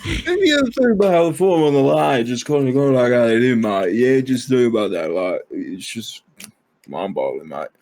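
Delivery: thick, barely understandable mock British; strong British accent. Host mimicking unintelligible British people, strong British accent. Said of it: "really strong Northern accent".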